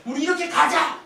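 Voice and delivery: high, loud burst; spirited pulpit shout; rallying call. Acted. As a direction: call the congregation to come along. Preacher voices a rallying call.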